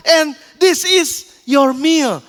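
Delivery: very slow, drawn-out flourish; grand meal presentation. Acted. Flight attendant serving a makeshift meal, slow and ceremonious. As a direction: stretch every word as you present the dish.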